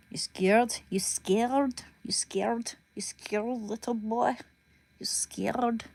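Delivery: taunting in a put-on Russian accent; repeated teasing challenge with a Russian accent. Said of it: "I'll get that Russian accent down".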